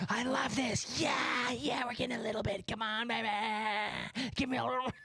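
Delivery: high put-on creature voice, revelling; gleeful unleashed gargoyle. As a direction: whoop it up like a gargoyle let loose. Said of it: "he's just like".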